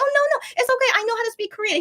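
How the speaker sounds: high, eager reassurance. Retold or imitated. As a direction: rush to reassure him, voice high. Reassuring him she speaks Korean.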